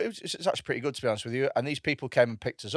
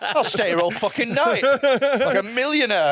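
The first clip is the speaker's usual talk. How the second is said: raised, hammed-up bragging; mock extravagance; 8 semitones higher, slower than usual. Joking about living it up on eighty pounds, mock boasting. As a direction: play the big spender, pitched high. Riffing on spending like a millionaire.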